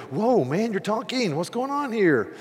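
high, astonished exclamation at a talking donkey; amazed whoa. Retold or imitated. Preacher imagining his own donkey talking, amazed high voice. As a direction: gasp it out like your donkey just spoke. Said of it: "I'd go".

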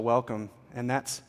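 ordinary talk

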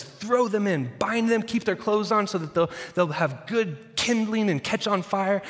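furious order. Acted. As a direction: bark the order in a rage. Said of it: "even more furious".

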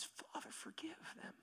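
faint, breath-only whisper; hushed plea for mercy. Acted. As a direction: whisper it like a dying prayer. Acts Jesus's dying plea.

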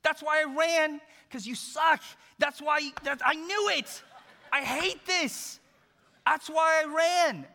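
a hammed-up sulky outburst at God; mock tantrum. Jonah ranting at God, loud high mock tantrum. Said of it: "He goes".

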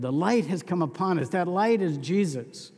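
ordinary talk, nothing marked

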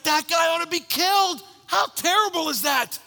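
outraged at the thief, demanding death; indignant shout; outraged king. Retold or imitated. David's outraged verdict.

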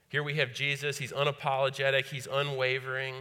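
loud, driving sermon voice; fervent preaching. Acted. Preaching at full force.

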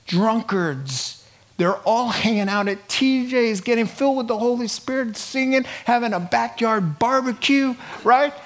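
ordinary talk

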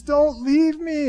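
put-on high, slow pleading child voice; mock little-girl plea at drop-off; clinging little girl. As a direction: plead like a scared toddler. Daughter clinging at preschool drop-off.

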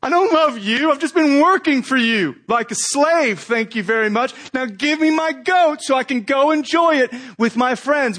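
a hint of a sulking son; resentful son. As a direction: spit it out like an ungrateful son.

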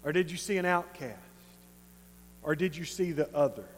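Jesus softly asking Simon to see the woman; gentle Jesus. Retold or imitated. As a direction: speak low and kind, like a patient teacher.

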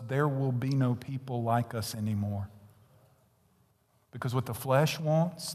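usual speaking voice

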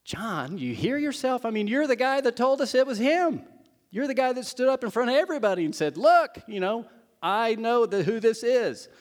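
high, loud disbelief, rushed; incredulous buddy. Retold or imitated. John's buddy scolding him, high loud incredulous. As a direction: pile it on in exasperated disbelief. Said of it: "I'd be like".

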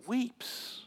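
soft, hushed word. A narrator's reading. Preacher telling of Jesus weeping, hushed. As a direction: let it fall quietly.

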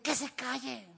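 mock scolding parent; imitated parental hushing. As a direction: pitch it up, shushing a child sternly. Acted parent hushing a pinched child.

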